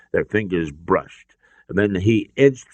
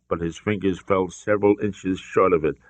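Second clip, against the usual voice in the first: ordinary delivery, nothing marked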